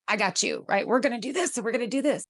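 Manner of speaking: fast, rushed fix-it urgency; anxious parent jumping into action; panicked parent part. Retold or imitated. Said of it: "freak out and be like".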